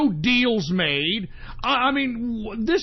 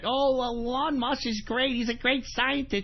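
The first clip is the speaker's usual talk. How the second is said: soft, mocking whiny fan voice; lightly mocked fawning fan voice; mocking Musk fans; 3 semitones higher, 5 dB quieter than usual. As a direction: sneer at the fans by imitating them. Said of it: "they're bitching and moaning".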